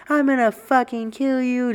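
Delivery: high-pitched, enraged threat; furious mom. Retold or imitated. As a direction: threaten like a mother beside herself. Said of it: "Just losing her mind".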